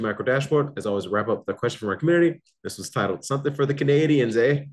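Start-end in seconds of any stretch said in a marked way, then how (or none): 3.2-4.6 put-on a quick, jokey Canadian accent with a high "eh". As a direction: toss off a Canadian accent for the punchline. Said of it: "hope you guys enjoy my Canadian accent".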